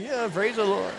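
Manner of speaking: half-hearted echo of someone's cheer; grudging agreement. Acted. Tired pastor answering his chipper colleague, grudging. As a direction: go along with the cheer reluctantly.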